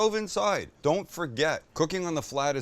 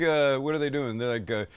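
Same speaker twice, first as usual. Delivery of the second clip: ordinary talk, nothing marked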